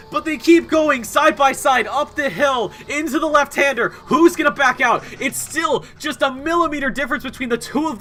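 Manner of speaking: high, loud, rushing race call; excited play-by-play. Acted. Commentator calling a wild final lap to viewers, shouting play-by-play. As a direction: yell the last lap as it unfolds.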